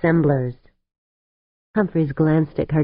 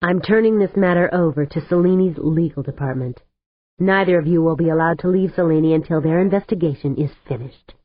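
ordinary delivery, nothing marked

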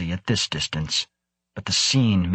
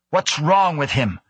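higher, urgent demand; demanding question; 7 semitones higher than usual. A narrator's reading. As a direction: demand an answer at once. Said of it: "Krebs demanded".

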